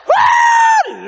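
loud high whoop; excited preacher's whoop. Real, not acted. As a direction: let out a big joyful whoop.

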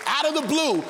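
high, rising preaching build; full-force preaching. Acted. Preacher stirring the congregation, rising full-force preaching. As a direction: preach it to the rafters.

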